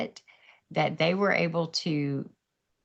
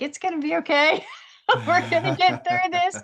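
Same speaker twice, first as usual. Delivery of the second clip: high, loud, laughing comfort; bright laughing reassurance, higher and louder; 13 semitones higher, 5 dB louder than usual. Retold or imitated. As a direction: cheerfully reassure crying mourners. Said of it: "And I'm like".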